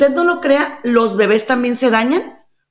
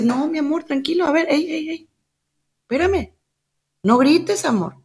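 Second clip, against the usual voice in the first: soothing mother; 3 semitones higher than usual. Retold or imitated. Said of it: "la mamá le dice". (mamá calmando al niño, voz aguda y suave)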